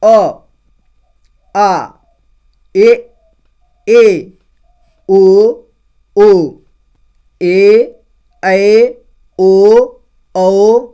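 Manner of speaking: each vowel called out loud and clear, with pauses; loud syllable drill. Shown as a demonstration. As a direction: recite the vowels slowly for the class. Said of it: "तो देखिए". (ज़ोर से धीरे-धीरे स्वर बोलना)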